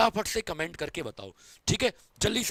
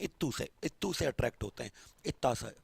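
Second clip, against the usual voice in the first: tiny cutesy voice; 8 semitones lower, 9 dB quieter than usual. Acted. Showing how tiny the pull is.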